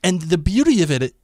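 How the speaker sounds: usual speaking voice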